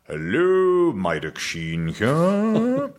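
slow, drawn-out posh Welsh greeting. Shown as a demonstration. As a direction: swoop up high like a Welshman putting on posh. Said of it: "when he does his posh voice".

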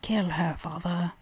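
put-on soft prince in a dubious British accent; badly faked British accent, quietly; attempted British accent. Prince whispering to his dad, in a wobbly British accent. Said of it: "I was doing a British accent".

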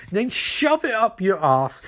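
high, slow, drawn-out insult; mock furious retort. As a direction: stretch it out in acted high-pitched outrage. [name] insults Elbard in acted quarrel.

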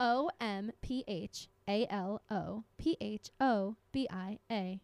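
letters O M P H A L O P H O B I A said one by one, slowly; spelling it out. Shown as a demonstration. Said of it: "Spell it".

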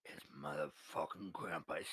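low, slow tough-guy Brooklyn drawl; Brooklyn accent. Pretend. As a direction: growl it like a Brooklyn wiseguy. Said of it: "with a Brooklyn accent".

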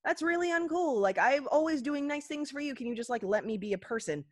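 high-pitched mock exasperation; fed-up mother re-enactment. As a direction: act out a mom finally complaining. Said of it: "she has to have one of her first legit moments".

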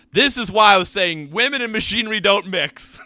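loud, high blustering declaration; hammed-up end of an argument; Archibald. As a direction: proclaim it like a pompous know-it-all. Acts out Archibald ending an argument.

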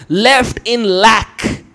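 very slow, each word apart; slow emphasis. Shown as a demonstration. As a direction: draw out every word. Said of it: "slowly defining Greek word".

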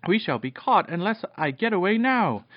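high-pitched alarmed urging; urgent warning. A narrator's reading. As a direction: warn them with rising urgency. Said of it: "he called".

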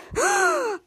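high, sharp intake of breath; acted gasp. Nana Winter reacts to the unmasking, high gasp. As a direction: gasp in mock shock.

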